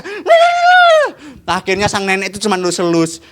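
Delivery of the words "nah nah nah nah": very high hammed-up shouting; angry shout. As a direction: shriek the scolding like a cartoon. Mimicking the angry grandfather. (kakek berteriak marah dengan suara sangat tinggi)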